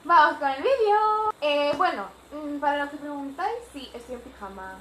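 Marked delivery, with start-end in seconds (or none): 0.0-1.3 loud, high, drawn-out kickoff; excited announcement. Real, not acted. Vlogger launching the story for viewers, loud and excited. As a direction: call it out big and slow.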